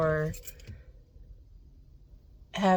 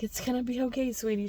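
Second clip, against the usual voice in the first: higher, softer soothing voice; retold comforting; 5 semitones higher, 7 dB quieter, faster than usual. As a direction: gently voice a stranger's comfort. Stranger comforting her as she cries.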